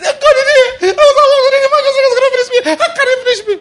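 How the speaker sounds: very high, loud hammed-up crying plea; mock crying prayer. Preacher parodies a tearful complaint prayer to God. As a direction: wail it like a fake sob.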